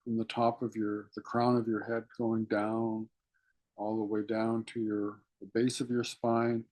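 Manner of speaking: guided meditation. Acted. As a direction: draw them inward with hushed, patient instruction. Leads breathing meditation.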